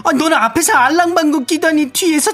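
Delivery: high-pitched, explosive shrieking fury; acted rage. Overheard coworker confronting a backbiter, hammed-up furious yelling. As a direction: confront the two-faced backbiter at a screech. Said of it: "쇼리 씨 분노 연기 잘하시네".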